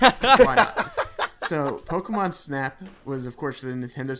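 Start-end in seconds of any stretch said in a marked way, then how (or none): 0.0-0.9 laughing shrug. Real, not acted. Laughing, moves game first.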